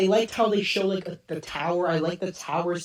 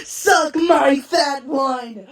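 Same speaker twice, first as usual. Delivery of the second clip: slow, drawn-out, higher, louder taunt; bratty kid; 7 semitones higher, 6 dB louder, much slower than usual. Retold or imitated. Kid from the show taunting someone, slow loud drawn-out yell. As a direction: yell it like a cocky kid picking a fight. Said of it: "the kid that goes".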